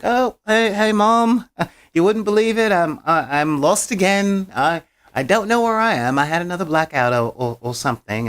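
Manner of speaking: high, flustered imitation of a son phoning home; put-on sheepish babble, pitched right up; Steven. Son phoning Mom about being lost, imitated flustered cheer.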